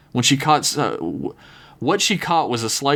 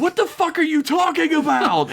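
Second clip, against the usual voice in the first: shrill scream of disbelief. Acted. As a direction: scream it at her in shock. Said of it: "Angela screamed out".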